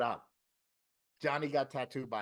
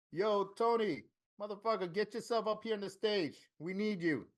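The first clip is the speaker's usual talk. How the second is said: higher, hyped-up call to the stage; excited call out; 7 semitones higher than usual. Retold or imitated. Joey calling Tony up to the stage, excited higher call. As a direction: summon him up like an MC hyping the crowd.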